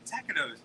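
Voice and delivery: "taquitos" said as TACK-uh-nose; mock mangled "taquitos", an N in place of the T; mispronunciation. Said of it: "this guy I knew from New York".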